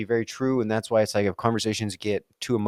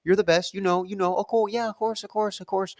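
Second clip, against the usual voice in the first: much higher, rapid-fire mock agreement; placating yes-man; 9 semitones higher than usual. To an emotional arguer, a much higher mock placating yes-yes. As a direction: humor them with a string of high, hurried yeahs. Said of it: "Or I have to be like".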